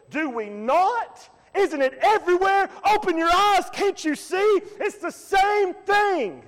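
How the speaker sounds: high, loud pleading cry; preaching shout. Real, not acted. Preacher crying out to the congregation, shouting. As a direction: shout it to wake them up.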